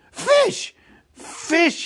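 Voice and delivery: very high, loud excited shout; crying out. Acted. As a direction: shout the answer in sudden triumph. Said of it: "he cried".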